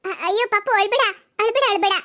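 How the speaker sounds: sing-song don't-cry to the infant; soothing a baby. Acted. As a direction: coax the crying child to stop.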